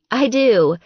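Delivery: cheerful. A narrator's reading. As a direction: chirp it back happily. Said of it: "she said cheerfully".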